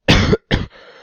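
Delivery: harsh, real chesty cough; coughing fit. Real, not acted. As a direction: cough hard into the mic.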